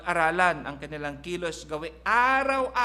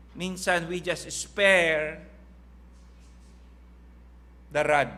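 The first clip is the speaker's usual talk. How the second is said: very slow, louder on the rod; drawn-out emphasis; 5 dB louder, slower than usual. Acted. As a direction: stretch out spare the rod for weight. Preacher dwells on key phrase.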